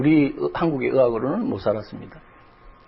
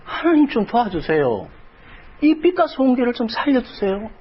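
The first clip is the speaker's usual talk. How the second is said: much higher, strained begging cry; retold wailing prayer; 8 semitones higher than usual. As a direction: plead with God to save the church, near tears. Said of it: "그렇게 부-, 울부짖었습니다". (높고 절박하게 울부짖는 기도)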